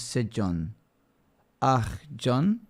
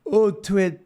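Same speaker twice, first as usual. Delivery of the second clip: mock excited; 8 semitones higher, 5 dB louder than usual. Mimicking the app's excited voice.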